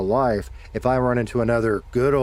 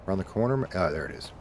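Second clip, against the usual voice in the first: usual speaking voice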